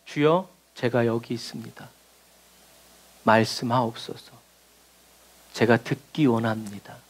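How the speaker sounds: a hushed, reverent plea; humble quiet prayer. Acted. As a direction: pray it softly and humbly, slowly.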